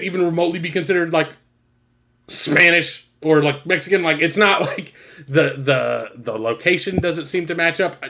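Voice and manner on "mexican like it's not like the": ordinary talk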